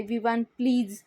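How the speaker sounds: ordinary delivery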